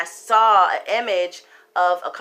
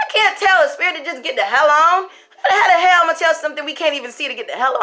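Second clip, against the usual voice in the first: high, loud, fast disbelieving protest; loud, fast, incredulous retort; exasperated protest; 8 semitones higher, 7 dB louder than usual. Retold or imitated. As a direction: push back in loud disbelief. Arguing back at fiance.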